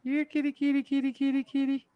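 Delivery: high cooing cat call; kitty call. Acted. Calling a cat, teasing.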